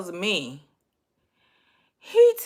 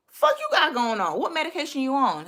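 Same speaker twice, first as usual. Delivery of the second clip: quick, raised-pitch mock demand; blunt inner demand; 6 semitones higher than usual. Woman thinking what she cannot ask her date, bluntly indignant. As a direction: fire off the rude version in your head. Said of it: "So I'm thinking like".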